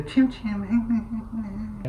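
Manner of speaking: squeaky high little critter voice; cute creature voice. Pretend. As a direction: play a tiny desert critter. Playful Chim Chim creature voice.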